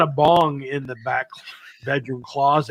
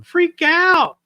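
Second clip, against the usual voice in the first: put-on high, louder little-girl call; little girl's voice; 5 dB louder than usual. Retelling a ghostly child on the recording, high girlish voice. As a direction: pipe up like a small girl. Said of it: "the little girl's voice going".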